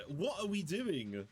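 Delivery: ordinary talk, nothing marked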